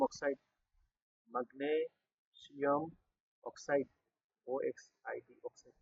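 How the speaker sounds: barely voiced, slow syllables while writing; murmured spelling-out. Real, not acted. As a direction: say it very softly, stretched to the pace of the pen. Saying the words while writing them.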